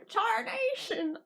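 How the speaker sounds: hint of a Texan yell; lightly Texan Blue Meanie; Texan exclamation. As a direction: drawl it Texan style. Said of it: "suddenly Texan now".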